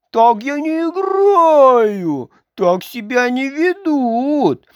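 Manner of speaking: sulky Karlsson whining; sulking. A narrator's reading. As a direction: whine like an offended child. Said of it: "он надулся".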